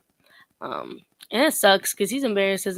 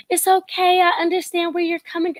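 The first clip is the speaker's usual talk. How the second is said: high, loud sugary reassurance; mock soothing woman; 10 semitones higher, 7 dB louder than usual. Imagined woman comforting Derek, high sugary mock reassurance. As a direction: coo it like a fan comforting him. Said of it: "one woman to be like".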